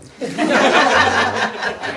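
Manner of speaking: high burst of laughter; loud laugh. Real, not acted. Laughs at wife's quote.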